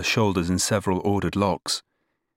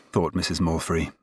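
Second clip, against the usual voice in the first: plain reading voice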